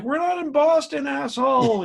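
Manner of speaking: heckling shout. Retold or imitated. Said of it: "And we'd be like".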